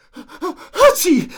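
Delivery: big, high-pitched mock sneeze; loud stagey achoo mid-sentence; acted sneeze. (lautes, hohes gespieltes Niesen)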